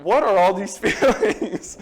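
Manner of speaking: loud, high comic bewilderment; hammed-up incredulous outburst; mock exasperation. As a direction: wail it in pretend exasperation. Retold exasperation at chart.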